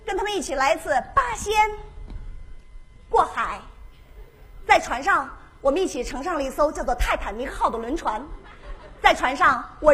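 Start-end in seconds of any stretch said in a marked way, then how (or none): none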